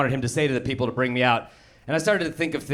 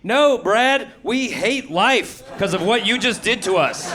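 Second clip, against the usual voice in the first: whole room answering back in dismay; crowd groan; 6 semitones higher than usual. Retold or imitated. Audience answers the bombing opener, high mock dismay. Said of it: "everyone's like".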